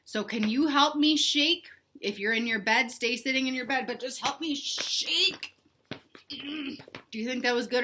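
usual speaking voice

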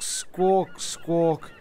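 ordinary talk, nothing marked

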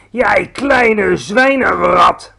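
loud, slow furious giant; roaring insult. A narrator's reading. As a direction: roar the insult at him. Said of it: "brulde de bloedbottelaar". (woedend, langzaam gebruld)

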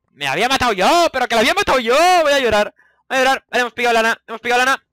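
high, loud rapid outburst; excited rant. Real, not acted. Gamer venting at a teammate then cheering, loud excited. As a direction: vent then cheer at once.

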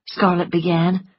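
plain reading voice

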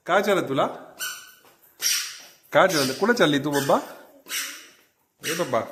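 put-on high, coaxing baby talk to a cat; pet talk. Owner coaxing the cat Mau, high baby talk.

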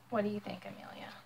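a soft whispered question. Real, not acted. Asking Amelia during the story, whispered. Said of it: "asks child quietly".

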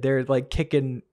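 ordinary talk, nothing marked

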